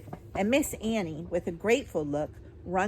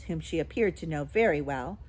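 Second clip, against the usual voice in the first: plain reading voice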